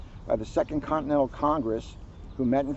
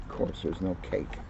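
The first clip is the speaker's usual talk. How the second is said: deadpan aside; 7 semitones lower, 8 dB quieter than usual. Real, not acted. Dry aside during reading.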